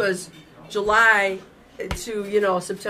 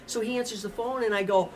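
ordinary talk, nothing marked

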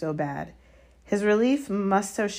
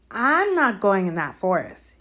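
higher, louder refusal; note of panic; 4 semitones higher, 6 dB louder than usual. A narrator's reading. Malfoy refusing to enter the forest, a note of panic. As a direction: balk with panic creeping in. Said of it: "the note of panic in his voice".

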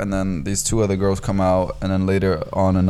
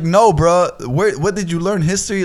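exasperated rebuttal; 7 semitones higher than usual. Retold or imitated. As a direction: retell setting someone straight in exasperation.

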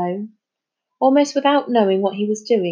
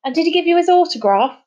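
high, fast, louder question; breathless excitement; 9 semitones higher, 5 dB louder, faster than usual. A narrator's reading. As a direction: rush out the eager question. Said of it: "said Charlie breathlessly".

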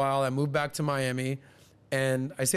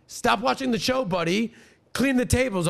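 higher, louder scolding of the busboy; retold yelling; 8 semitones higher, 4 dB louder than usual. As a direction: snap orders at a slacking worker. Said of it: "I got yelled at four times".

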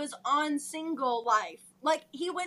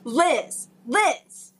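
loud; 3 semitones lower, 8 dB louder than usual. Acted. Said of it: "resume our dramatic reading".

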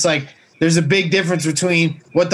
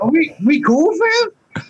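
mock crying; 10 semitones higher, slower than usual.

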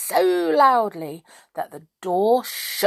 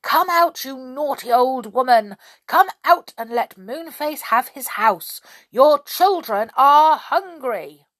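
high, loud storybook shout; insistent shout, slowing at the end; shouting loudly; 6 semitones higher, 6 dB louder than usual. A narrator's reading. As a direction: call them out at full voice. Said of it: "shouted at the top of his voice".

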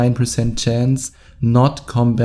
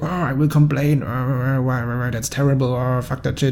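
mock grumbling German tourist, softer and muttered; whiny complaining German; 5 dB quieter than usual. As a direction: grumble and moan, quietly fed up. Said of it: "I could have been all German and go like".

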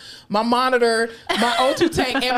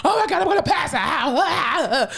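slow drawn-out moaning, higher; mock whining; 5 semitones higher, slower than usual. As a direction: whine like a worn-out patient. Patient whining while dragged.

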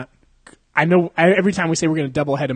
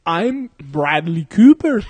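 drawn-out, loud fake Scottish accent; botched Scottish accent; 3 semitones higher, 7 dB louder, much slower than usual. Actor retrying his introduction, drawn-out botched Scottish accent. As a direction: stretch out a hopeless attempt at Scottish. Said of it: "how to do a Scottish accent".

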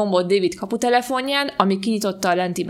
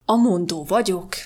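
Mr Harris. A narrator's reading. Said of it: "mondta végre".

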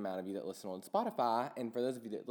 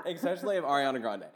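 laughing while talking, quick; 3 semitones higher, 6 dB louder, faster than usual. Real, not acted. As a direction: chuckle through the words. Laughing at own Snapchat overload.

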